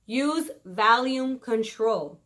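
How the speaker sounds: slowed, deliberate; slow emphasis. Shown as a demonstration. Vlogger repeating tip, slow deliberate. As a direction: spell out the tip slowly.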